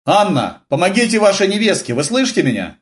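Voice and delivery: high, projected request; calling out. A narrator's reading. Major asks Anna to help Eva, calling out. Major calls to another woman afar.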